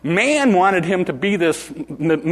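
ordinary talk, nothing marked

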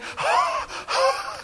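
sharp high breathless gasp; startled gasp. Retold or imitated. Hunter scared by a hidden man, high gasp. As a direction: gasp like you've been scared stiff.

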